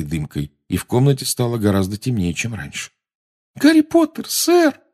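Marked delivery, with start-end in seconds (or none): 3.6-4.8 very high, squeaking house elf; squeaky elf voice. Acted. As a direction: squeak it out, trembling. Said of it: "пропищал эльф".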